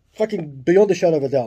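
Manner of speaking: emphatic insistence. Retold or imitated. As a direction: swear he's dead sure of it. Insisting to detective.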